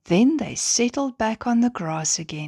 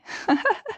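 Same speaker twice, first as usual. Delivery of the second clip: high little secretive giggle; mysterious giggle. Acted. Stella teases the curious stars with a giggle. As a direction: giggle like you know a secret. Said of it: "Stella giggled mysteriously".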